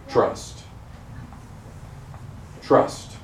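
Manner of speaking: a hushed, breathy trust, twice; whispered reveal. Acted. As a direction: breathe the word like a secret. Revealing the plate's word.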